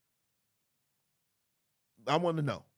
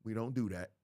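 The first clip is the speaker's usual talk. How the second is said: usual speaking voice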